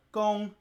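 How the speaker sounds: Gong held on a high level pitch; high flat tone. Shown as a demonstration. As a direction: keep Gong high and flat, Mandarin first tone. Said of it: "This is the high tone".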